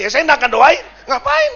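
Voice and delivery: loud, high exclamation of refusal; indignant outburst. Acted. Preacher scoffing at praying for such a couple, high indignant shout. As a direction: voice leaping up in mock outrage.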